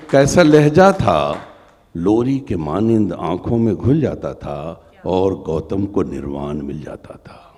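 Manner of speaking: measured poem recital; stately nazm recitation. Acted. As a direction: recite with feeling.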